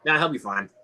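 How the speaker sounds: ordinary delivery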